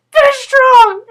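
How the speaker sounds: a rallying cry that cracks into giggles; laughing pep call. Retold or imitated. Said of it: "So I was just like".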